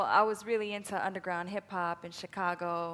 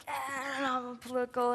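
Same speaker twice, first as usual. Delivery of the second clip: put-on higher-pitched eager teen activist; eager mock outburst; 6 semitones higher, 4 dB louder, slower than usual. College talk on her overachieving high school self, mock eager. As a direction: play up the keen overachiever.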